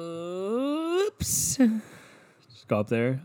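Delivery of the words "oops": high drawn-out "oops"; oooops, pitched up and held; long mock oops. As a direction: stretch a rising oops in fake innocence.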